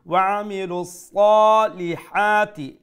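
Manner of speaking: loud, high, slow chant with long সা and হা in সালিহাতি; long madd recitation. Shown as a demonstration. Teacher reciting for students, loud chant stretching সালিহাতি. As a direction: intone সালিহাতি with full s and stretched a vowels. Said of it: "এক আলিফ লম্বা করে".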